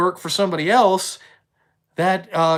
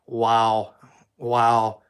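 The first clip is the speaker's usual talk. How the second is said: stunned wow; 7 semitones lower than usual. Retold or imitated. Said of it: "I'm just like".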